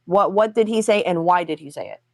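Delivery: ordinary talk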